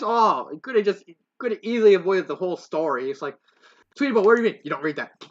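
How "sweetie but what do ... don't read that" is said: mock scolding. Voices a sister scolding Sweetie.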